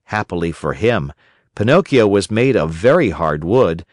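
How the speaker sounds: ordinary delivery, nothing marked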